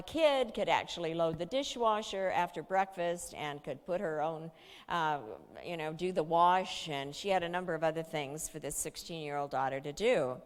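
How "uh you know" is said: usual speaking voice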